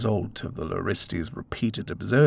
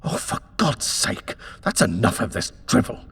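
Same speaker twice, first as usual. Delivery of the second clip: sudden exasperated outburst, louder and quick; burst out; 4 dB louder than usual. Acted. Husband bursts out at the superstitious landlady, angry. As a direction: lose patience and snap out the words. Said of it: "Edward burst out".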